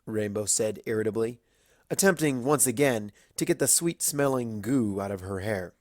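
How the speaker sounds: plain reading voice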